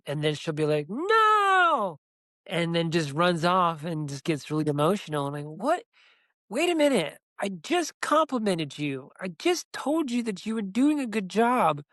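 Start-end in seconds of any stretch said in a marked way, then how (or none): 0.9-2.0 a much higher, louder cry of no; child's protest. Retold or imitated. Wanda refusing a compliment. 5.5-11.8 baffled parent. Retold or imitated. Dad baffled by child's outburst.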